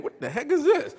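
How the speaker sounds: high-pitched, laughing bewilderment; baffled reaction. Retold or imitated. Ex-prisoner recalling his first look at a phone, baffled. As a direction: act out mock bewilderment through a laugh.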